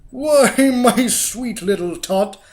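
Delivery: a villain faking kindness; fake sweet Grinch. A narrator's reading. The Grinch lies to the little girl, loud and sugary. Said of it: "the fake Grinchy Claus lied".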